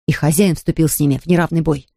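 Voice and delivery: plain reading voice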